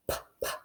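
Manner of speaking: "p, p" as two soft puffed p sounds. Shown as a demonstration. Teacher sounding out the letter p for kindergartners. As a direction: puff out "p" quietly twice. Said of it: "this one says".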